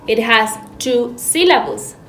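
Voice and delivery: normal talking voice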